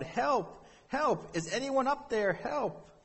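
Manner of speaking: high-pitched cry for help, not full volume; acted, high, stretched-out yell; mock yell for help. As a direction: call up for help from below. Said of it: "and he yelled".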